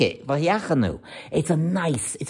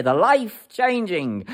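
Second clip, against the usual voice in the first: slow, weighted "life-changing"; stress on life-changing; 3 semitones higher, slower than usual. Shown as a demonstration. Preacher to listeners, stressing "life-changing" slowly. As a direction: lean on "life-changing", drawn out. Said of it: "I emphasize the word life-changing".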